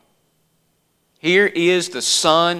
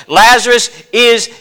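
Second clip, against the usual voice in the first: firm and final; 5 semitones higher, 6 dB louder, slower than usual. Acted. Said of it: "There is a firmness and a finality".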